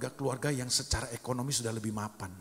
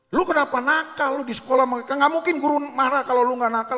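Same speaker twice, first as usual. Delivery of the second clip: angry parent scolding; 13 semitones higher, 11 dB louder than usual. Retold or imitated. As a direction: tell the kid off at full volume like an angry parent. Orang tua memarahi anak. (orang tua memarahi anak yang mengadu soal guru, berteriak marah)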